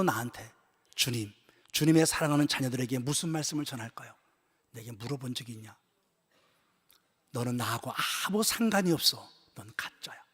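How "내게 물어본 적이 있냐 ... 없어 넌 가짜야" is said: low, soft solemn rebuke; quiet, grave judgment; God's rebuke. Retold or imitated. God rebuking the pastor, low and soft. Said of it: "주님이 이렇게 내게 말씀하시더라고".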